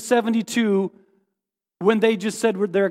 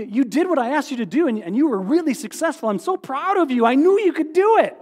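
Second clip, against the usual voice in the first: imagined praise; 4 semitones higher, 3 dB louder than usual. Retold or imitated. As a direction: praise building up higher and louder, proud coach. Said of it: "it sounds to me like Jesus would say".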